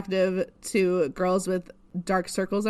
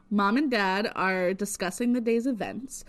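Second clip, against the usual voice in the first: normal talking voice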